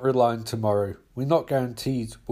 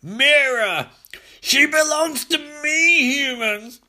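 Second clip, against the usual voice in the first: high, loud drawn-out claim; possessive shout; 12 semitones higher, 4 dB louder, slower than usual. Acted. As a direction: shriek that she is yours. Villain screams claim on Mira.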